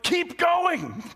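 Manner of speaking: shouting at the screen, urging him on. Retold or imitated. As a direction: yell at the screen like a moviegoer. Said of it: "you're like".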